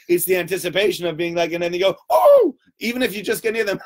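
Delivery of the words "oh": high, loud whoop; sudden playful "oh!" shot up high; mock tickle pounce.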